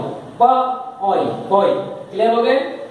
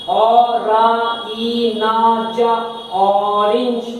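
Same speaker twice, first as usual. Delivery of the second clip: slow syllable-by-syllable ओ रा ई ना ज; sounded out slowly; much slower than usual. Shown as a demonstration. Teacher sounds out orange for pupils, very slow. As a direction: break orange into slow sounds. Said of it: "पर sound क्या है?".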